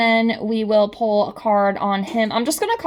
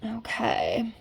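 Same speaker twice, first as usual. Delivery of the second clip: whispering; 8 dB quieter than usual. Real, not acted. Whispered okay while reading cards.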